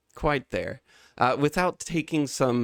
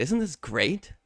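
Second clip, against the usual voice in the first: dreamy smiling crowd; 4 semitones higher than usual. Acted. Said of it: "and they say".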